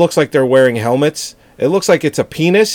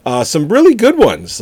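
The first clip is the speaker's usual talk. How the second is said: usual speaking voice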